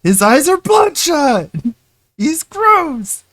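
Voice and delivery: high, loud imitating shout; mocking shout. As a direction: yell it like the host ranting. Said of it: "Just being like".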